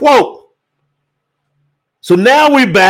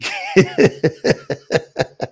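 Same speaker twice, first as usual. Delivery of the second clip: high laugh; 5 dB quieter than usual. Real, not acted. Preacher laughing at a viewer's comment, high laugh.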